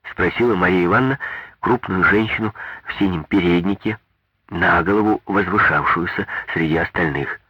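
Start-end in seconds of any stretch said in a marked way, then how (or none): none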